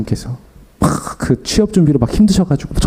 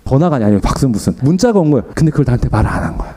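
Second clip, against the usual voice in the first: usual speaking voice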